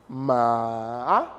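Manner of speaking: "ma" long and low, then swooping up; dipping third tone. Shown as a demonstration. Teacher showing M with A, "ma" falling then rising. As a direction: sink low on "ma", linger, then climb. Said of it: "Le troisième ton".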